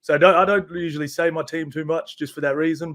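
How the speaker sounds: normal talking voice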